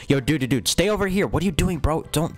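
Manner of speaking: ordinary talk, nothing marked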